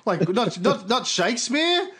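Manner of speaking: high-pitched, stammering disbelief; incredulous. Acted. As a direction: protest as if it's obvious. Incredulous objection to the praise.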